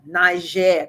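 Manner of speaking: Niger said the French way, nee-ZHEH; French pronunciation. Shown as a demonstration. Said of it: "'cause it's French".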